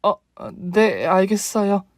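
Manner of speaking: lightly voiced timid daughter-in-law; meek reluctant yes. A narrator's reading. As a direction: give in hesitantly.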